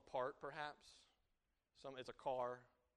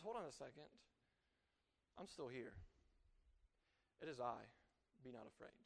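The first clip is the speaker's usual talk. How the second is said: low, hushed voice after the storm; steady them softly, with pauses; quiet, unhurried comfort; calm reassurance; 5 semitones lower, 9 dB quieter than usual. Acted. God calmly answers the panic.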